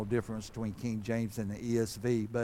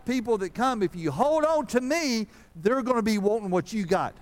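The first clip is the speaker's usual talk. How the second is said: full-force preaching; 9 semitones higher, 5 dB louder than usual. Acted. God urges suffering Job to hold on, in high loud fervent preaching. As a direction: build the promise up and hammer it home.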